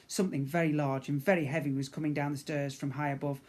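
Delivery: ordinary delivery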